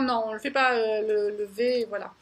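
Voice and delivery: ordinary talk, nothing marked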